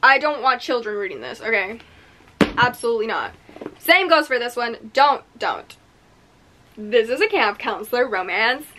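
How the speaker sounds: ordinary delivery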